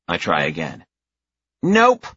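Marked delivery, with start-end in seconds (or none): 1.6-2.1 put-on very high, loud child's nope; gleeful little girl. A narrator's reading. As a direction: tease like a small kid. Girl rejecting his guess.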